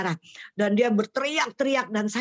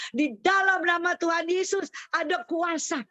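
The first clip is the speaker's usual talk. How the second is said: mild imitation of a shrieked declaration; retold scream; 7 semitones higher than usual. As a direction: imitate crying out a prayer. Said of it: "saya dengar Ibu menjerit".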